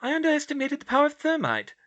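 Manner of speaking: high, quick, loud protest; indignant squeak of self-defence. A narrator's reading. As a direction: defend yourself, affronted. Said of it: "she said indignantly".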